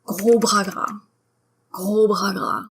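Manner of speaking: "Gros bras gras" with dry gargled R's; French R. Shown as a demonstration. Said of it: "to make a French R".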